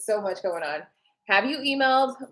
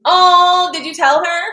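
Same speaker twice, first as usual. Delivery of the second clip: excited; 8 semitones higher, 11 dB louder, slower than usual. Real, not acted. Excited question to commenter.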